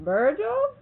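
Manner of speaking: high, loud, building to a laughing shout; laughing retort. Retold or imitated. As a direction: deliver the punchline, cracking up at the end. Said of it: "I says to him".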